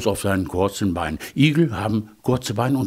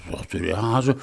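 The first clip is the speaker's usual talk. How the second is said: usual speaking voice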